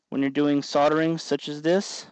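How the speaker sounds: normal talking voice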